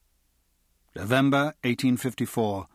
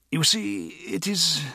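high, hesitant and embarrassed; faltering, breaking off; 8 semitones higher, slower than usual. A narrator's reading. Embarrassed man tries again to confess to friend, faltering. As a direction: struggle to begin, voice high, then break off. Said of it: "he broke off and shook his head".

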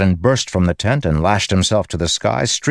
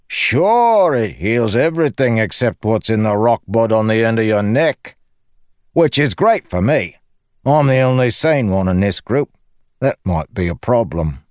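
high, cocky joker's voice; blithely self-satisfied character banter; cheeky Lopen, breezy; 5 semitones higher, 3 dB louder than usual. Acted. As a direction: swoop up high, then chatter breezily. Lopen's dialogue in narrated story.

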